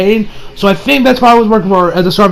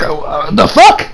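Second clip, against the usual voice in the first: stunned disbelief; 9 semitones higher, much slower than usual. Retold or imitated. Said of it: "Ben's like".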